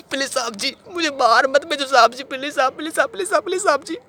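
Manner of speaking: very high, loud, wailing plea; frantic begging. Acted. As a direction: beg for mercy at the top of your pitch. Climax of acted begging for mercy.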